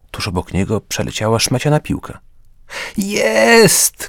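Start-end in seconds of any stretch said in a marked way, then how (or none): 3.0-4.1 sharp, high cry of joy over a goal; a father's high whoop of victory; triumphant shout. A narrator's reading. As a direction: yell it as the ball goes in. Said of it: "krzyknął Karol Wojtyła senior".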